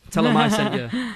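laughing cheekily to the co-host. Real, not acted. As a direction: giggle through it as a cheeky joke. Jokey aside, laughing.

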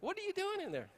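lightly voiced high child asking; curious kids. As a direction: ask like a puzzled little kid. Kids asking dad in closet.

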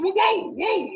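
high-pitched, startled protest; mock-alarmed, cut in to stop it; retold alarm. Visitor reacting to a street smack, retold alarm.

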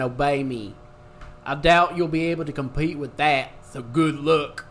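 loud swaggering dude voice; frat bro. Acted. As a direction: read it like a cocky bro. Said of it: "gonna get in my, uh, bro voice".

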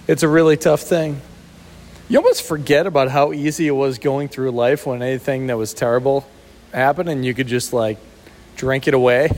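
normal talking voice

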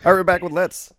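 big hyped podcast host announce; show announcer. Real, not acted. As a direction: call the show back in like a host. Announcing the segment return.

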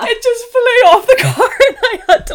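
loud, high, laughing through the words. Real, not acted. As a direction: barely get it out for laughing. Laughs while retelling the mishap.

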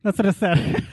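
giggling through the line; real laughter breaking in; laughing. Real, not acted. Laughing at the frog rhyme.

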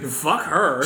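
ordinary delivery

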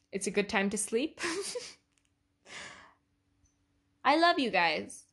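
a real tired laugh breaking into the words. Real, not acted. Signing off to listeners at midnight, laughing. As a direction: giggle through the goodnight to your listeners. Late-night sign-off to listeners.

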